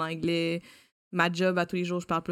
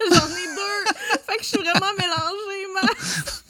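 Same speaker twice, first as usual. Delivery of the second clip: laughing; 13 semitones higher, 6 dB louder, slower than usual. Real, not acted. Embarrassed about her mother tongue.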